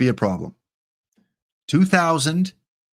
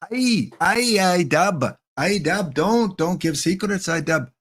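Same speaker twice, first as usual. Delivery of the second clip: higher, hammed-up Canadian accent; fake Canadian accent; 6 semitones higher, slower than usual. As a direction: tease the mod in a Canadian drawl. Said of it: "I perfected my fake accent".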